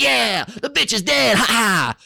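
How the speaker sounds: high gloating cheer with a laugh; gleeful celebrating crowd. Retold or imitated. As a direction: crow it high, cackling at the end. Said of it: "They're like".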